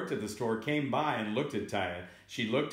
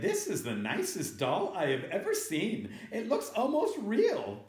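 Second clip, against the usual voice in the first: high, delighted lady voice; sweetly amazed woman shopper; admiring lady; 10 semitones higher than usual. Acted. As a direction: gush over it in a raised pitch. Story lady admires the doll.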